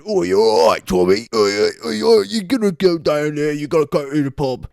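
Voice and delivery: high, loud, hammed-up Birmingham gangster accent; Peaky Blinders Birmingham accent. Vlogger mocks the show's local-businessman days in hammed-up Birmingham accent. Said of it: "that's my Peaky Blinders impression".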